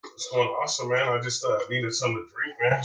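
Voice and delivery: voice giving out. Real, not acted. Voice going, needs a drink.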